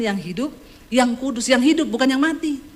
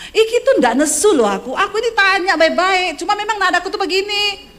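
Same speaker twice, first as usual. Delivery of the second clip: indignant protest; 8 semitones higher, 4 dB louder than usual. Retold or imitated. Wife protesting.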